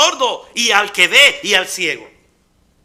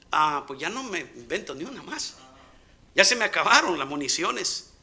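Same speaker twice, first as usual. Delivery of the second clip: defeated mumble; 5 semitones lower, 8 dB quieter than usual. Acted. Moisés giving up.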